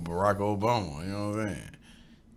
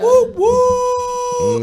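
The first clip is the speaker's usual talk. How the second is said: navy cheer; 9 dB louder than usual. Real, not acted. Cheering for his Navy past.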